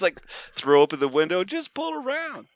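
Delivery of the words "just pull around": distant yell. Retold or imitated. Worker calls the car around, high distant yell. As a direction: call it out across the lot, much higher. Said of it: "he's yelling out the window".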